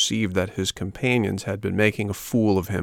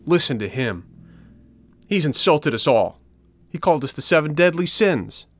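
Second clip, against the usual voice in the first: outraged; 7 semitones higher, 4 dB louder than usual. Acted. Boys react outraged to insult.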